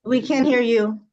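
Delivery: muffled, like through a wall. Real, not acted. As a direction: sound muffled by the room speaker. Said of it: "You sound muffled".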